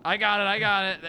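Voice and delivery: ordinary delivery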